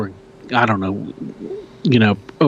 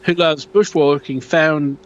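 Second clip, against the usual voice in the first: put-on Australian accent; 4 semitones higher than usual. Host reading a Yowie sighting post in a mock Australian accent. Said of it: "I think I'm getting better".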